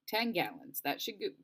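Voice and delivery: ordinary delivery, nothing marked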